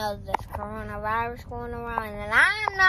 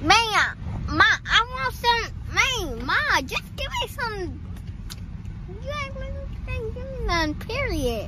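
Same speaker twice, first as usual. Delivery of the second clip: high, put-on ratchet pleading; ratchet talk; 9 semitones higher than usual. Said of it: "the ratchet talking prank on my mom".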